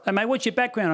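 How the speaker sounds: put-on Australian accent; nosy Australian. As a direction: imitate a prying Australian. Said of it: "They're always like".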